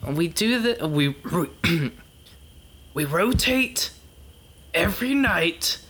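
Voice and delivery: high-pitched, halting exasperation; fed-up lecture with long stunned pauses; exasperated. Acted. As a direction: scold a hopeless underling, struggling for words. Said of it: "put a hand over my eyes".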